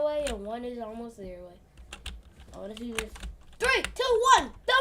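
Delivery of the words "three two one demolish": shouted countdown to launch the attack. Real, not acted. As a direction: yell the countdown, loud and high.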